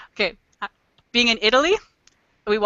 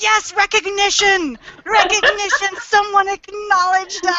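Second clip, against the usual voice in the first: high, gleeful mock celebration; delighted cheering; 8 semitones higher than usual. Canadians thrilled that someone acknowledged them, gleefully excited. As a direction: cheer it, drawing out the first words. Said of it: "we're not even upset about that".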